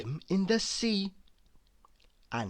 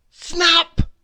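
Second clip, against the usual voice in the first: high, loud cry on snap; 11 semitones higher, 9 dB louder than usual. A narrator's reading. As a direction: make the snap burst out. Sound of snapping.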